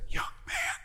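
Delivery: breathy retold whisper, very soft; hushed whisper over the phone; imitated whisper. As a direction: whisper it like a pastor on the phone at dawn. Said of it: "he's whispering".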